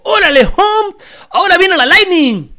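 high, loud mock outburst in Spanish; hyped Mexican truck fan. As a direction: shout it out like a fired-up fan. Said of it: "must have been mad as fuck".

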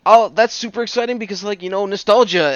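high-pitched retold excitement; reenacting an excited first reaction. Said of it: "I got excited".

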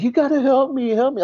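high, urgent imitated plea; desperate begging. Frightened woman begging paramedic.

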